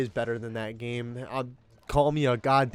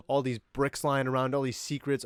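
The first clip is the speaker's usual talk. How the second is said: usual speaking voice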